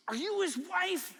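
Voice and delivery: high, pointed question from a suspicious stranger; suspicious woman. Retold or imitated. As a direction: ask it like a nosy woman sure of scandal. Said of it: "She's expecting her to say no".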